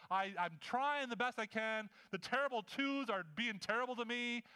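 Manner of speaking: high, insistent pleading tirade; frustrated outpouring. Acted. Preacher as overwhelmed Martha pouring out to Jesus, desperate frustration. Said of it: "her frustration drives how she comes to Jesus".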